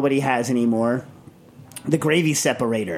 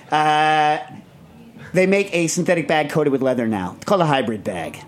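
ordinary talk, nothing marked

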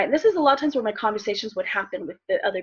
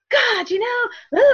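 high, strained cry of frustration at God; frustrated outburst; 11 semitones higher, much slower than usual. Retold or imitated. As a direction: yell it out, fed up and near tears. Angry young mom yelling at God.

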